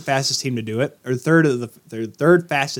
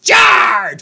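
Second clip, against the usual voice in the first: loud, high rally yell; rally the crowd; charge cry; 9 semitones higher, 5 dB louder than usual. Pretend.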